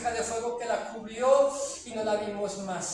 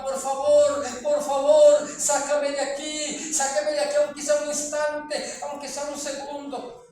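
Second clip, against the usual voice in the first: loud, higher, frantic plea; desperate begging; 3 semitones higher, 7 dB louder than usual. Retold or imitated. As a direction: cry out for one second of escape. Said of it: "pero extendía sus manos hacia arriba".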